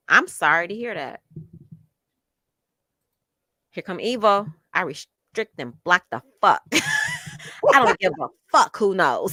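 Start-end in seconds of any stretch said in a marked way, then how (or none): 3.7-9.3 hammed-up evil voice; mock evil, breaking into laughter; Evo's evil voice. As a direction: playfully put on a villainous voice. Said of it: "That's my evil voice".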